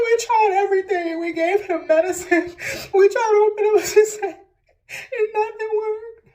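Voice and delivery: high, loud words breaking into crying; mother retelling the doctor's news while crying; tearful. Real, not acted. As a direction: choke the news out through tears.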